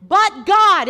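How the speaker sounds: high, loud rallying cry; preacher's shout. Real, not acted. Preacher cues the congregation to shout it back, shouted. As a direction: punch it out for a crowd to echo. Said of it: "everybody shout it".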